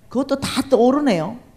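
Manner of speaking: usual speaking voice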